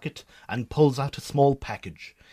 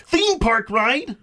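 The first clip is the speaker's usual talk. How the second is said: indignant echo; 8 semitones higher, 3 dB louder, slower than usual. Acted. [name] repeats, indignant.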